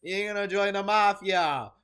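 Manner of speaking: sudden loud high outburst; shouting it out; loud exclamation. Real, not acted.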